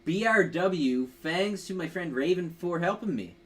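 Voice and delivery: ordinary talk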